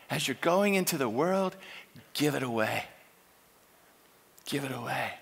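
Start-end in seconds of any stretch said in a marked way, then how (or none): none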